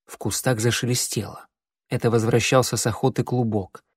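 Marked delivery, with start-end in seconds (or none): none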